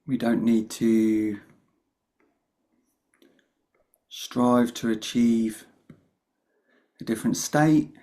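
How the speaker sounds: calm meditation guide with long pauses; guided meditation. Real, not acted. Meditation teacher opening a session for the group, unhurried guidance with long pauses. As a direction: lead them gently in, leaving long silences between phrases.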